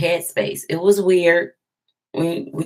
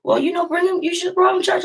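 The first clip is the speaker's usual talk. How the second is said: higher voice, lightly mimicking the pastor; imitating her pastor; 13 semitones higher, faster than usual. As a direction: play the pastor's gentle advice with a lifted voice. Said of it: "he was like".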